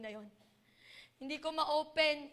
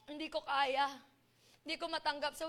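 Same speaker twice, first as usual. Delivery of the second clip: ordinary talk, nothing marked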